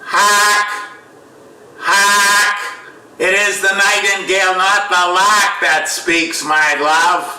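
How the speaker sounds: high, hammed-up Shakespeare with a Boston accent; horrible Boston accent. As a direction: declaim a love scene in broad Bostonian. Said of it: "you got that horrible Boston accent".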